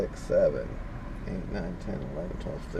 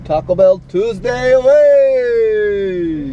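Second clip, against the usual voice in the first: high, loud, drawn-out hype call; stretched cheer, much higher and louder; excited shout; 11 semitones higher, 10 dB louder, much slower than usual. Real, not acted. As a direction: belt it out like a show opener. Hyped catchphrase shout.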